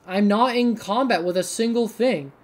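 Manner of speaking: ordinary delivery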